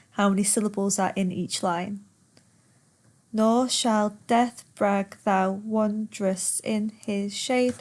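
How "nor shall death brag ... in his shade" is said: slow, syllable-by-syllable verse reading; per suku kata. Shown as a demonstration. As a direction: count out the line, one beat per syllable. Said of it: "Ten syllables".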